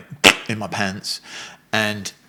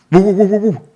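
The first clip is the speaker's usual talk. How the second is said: high, loud run of woofs; loud mock woofing; imitated dog bark; 11 semitones higher, 11 dB louder than usual. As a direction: mime a dog with quick repeated barks. Thai mimes dog for the meat.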